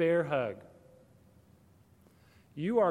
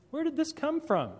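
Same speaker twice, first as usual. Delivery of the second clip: much higher, baffled question; puzzled parent; 12 semitones higher than usual. Retold or imitated. Parent wonders where the kids' harsh tone came from, up high. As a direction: sound genuinely puzzled, pitched way up.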